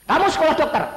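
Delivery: high, loud commanding father voice; stern father's order. Retold or imitated. Father ordering his son to become a doctor, loud and stern. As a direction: bark it like a strict father.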